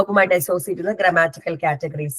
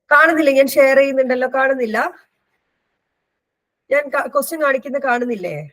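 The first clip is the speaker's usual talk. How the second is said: higher-pitched, questioning call to the class; puzzled calling out; 6 semitones higher, faster than usual. Real, not acted. Teacher asks online students whether the shared screen shows, puzzled calling out. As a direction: call out for someone to answer, pitch lifted.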